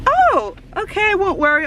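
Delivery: acted high-pitched fluster; starstruck compliance. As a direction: give in to your idol, starstruck. Retold flustered fan reply.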